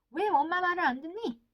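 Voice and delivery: loud, high accusing question; acted parent's sharp rebuke; harsh reproach. Parent blames child for not listening, acted reproach. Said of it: "거센 비난이기 때문이에요".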